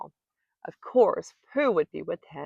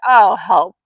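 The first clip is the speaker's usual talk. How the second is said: much louder, distressed call; loud cry for help; 11 dB louder than usual. A narrator's reading. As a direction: cry out as Pooh stuck in the jar. Pooh stuck inside the jar.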